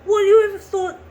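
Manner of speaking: high, loud distressed outburst; upset outburst. Retold or imitated. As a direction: cry out at dad in anguish. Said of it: "it's like".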